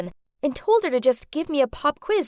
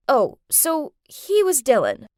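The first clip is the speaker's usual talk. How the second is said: ordinary delivery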